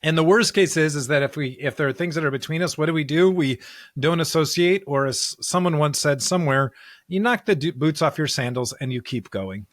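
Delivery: ordinary delivery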